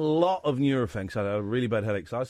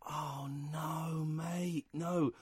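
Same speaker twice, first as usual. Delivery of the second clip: soft, crying groan of despair; hushed dismay; 9 dB quieter, much slower than usual. Real, not acted. Caller realising his prank report worked, in hushed crying dismay. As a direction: breathe it out like it's all gone wrong.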